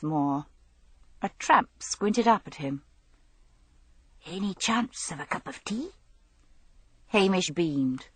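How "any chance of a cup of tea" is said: whispered, hopeful request. Acted. Tramp at Hamish's door asking for tea, whispered. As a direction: breathe the question softly.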